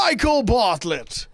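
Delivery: big, drawn-out show announcer intro; X Factor announcer. Acted. Host introducing his podcast like the X Factor announcer. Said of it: "supposed to be the X Factor guy?".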